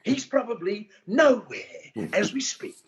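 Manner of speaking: a hint of a British narrator, laughing. Comedian muses on a missing comic, mild British narrator voice. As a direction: lightly put on a British documentary voice. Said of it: "You sound more like, um, that British narrator".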